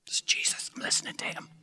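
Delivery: hushed whisper, not to disturb. Retold or imitated. Preacher voices Mary whispering to her sister, hushed. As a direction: whisper it so Jesus isn't interrupted.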